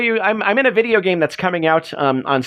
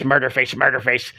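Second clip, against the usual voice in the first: William Murderface; 6 semitones lower, 3 dB quieter than usual. Retold or imitated. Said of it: "I got the William S".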